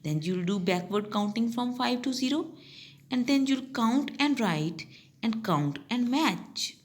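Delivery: normal talking voice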